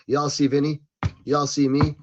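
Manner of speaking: normal talking voice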